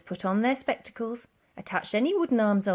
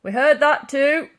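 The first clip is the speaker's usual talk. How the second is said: officer through door; 6 semitones higher, 9 dB louder than usual. A narrator's reading. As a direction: drag it out smugly through the door. Policeman replies through door.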